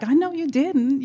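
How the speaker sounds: high, sassy, indignant retort; mock squaring up, full of attitude; sassy comeback. Wife squares up to usher.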